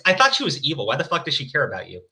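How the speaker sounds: plain reading voice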